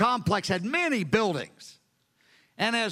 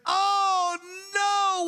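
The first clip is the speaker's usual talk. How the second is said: anguished cry; 10 semitones higher than usual. Retold or imitated. Peter realizing his denial, crying out in anguish. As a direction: break down in horror.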